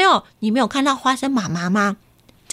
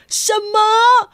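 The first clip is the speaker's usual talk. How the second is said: high startled cry; father startled by the phone call, crying out; loud; 10 semitones higher, slower than usual. Acted. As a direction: shout it out in shock. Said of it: "大声地说".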